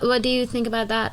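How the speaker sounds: normal talking voice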